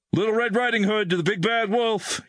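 high-pitched distress call; urgent radio announcement. A narrator's reading. Major radios base from the falling helicopter, urgent announcing. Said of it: "he announced, hoping his call was being monitored".

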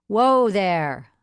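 loud call to the horse. A narrator's reading. Man calling to horse outside.

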